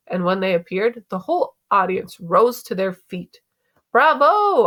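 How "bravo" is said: high, loud cheer; yelling. A narrator's reading. Dad cheering the curtain call, yelling. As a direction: call out a bravo from the audience. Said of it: "I heard Dad yelling through the, his hands".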